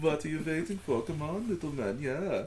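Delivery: teasing German come-on; German accent. Pretend. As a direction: purr in mock German. Joining the German sex voice.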